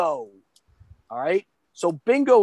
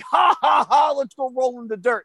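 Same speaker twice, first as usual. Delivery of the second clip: high, whooping fake laugh into words; mock gleeful kid; 7 semitones higher than usual. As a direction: imitate a kid thrilled by mess. Imitating kids delighted by mess.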